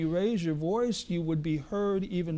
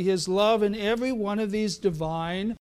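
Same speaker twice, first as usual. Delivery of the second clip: normal talking voice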